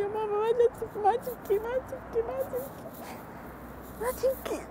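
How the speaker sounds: hint of children's voices comforting their crying mother; children pleading. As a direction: lightly imitate the kids begging mom not to cry. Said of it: "يقول لي".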